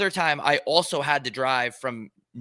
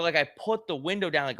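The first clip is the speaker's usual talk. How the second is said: ordinary delivery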